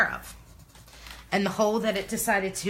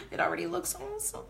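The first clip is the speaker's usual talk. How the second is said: soft, high-pitched squeal of excitement; excited; 13 semitones higher, 7 dB quieter than usual. Real, not acted. Crafter seeing the first color go down, hushed excitement. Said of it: "I'm so excited".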